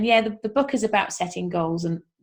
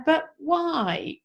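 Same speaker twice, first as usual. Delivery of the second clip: higher mock-child whine; whiny child; 8 semitones higher than usual. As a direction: play the pestering toddler. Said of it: "they ask you a question ... they go".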